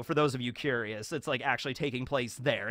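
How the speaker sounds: ordinary talk, nothing marked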